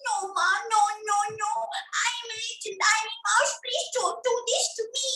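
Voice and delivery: high, quick, pleading mouse; high-pitched little mouse voice; squeaky mouse. Shown as a demonstration. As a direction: squeak it out as a tiny mouse begging. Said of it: "this is one squeaky voice for the mouse".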